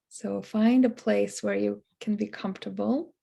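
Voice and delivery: soft, unhurried meditation voice; guided meditation. Acted. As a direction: gently ease listeners into relaxation, leaving long pauses. Leads into a meditation.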